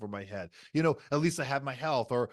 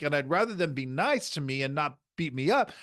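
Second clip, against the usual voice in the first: usual speaking voice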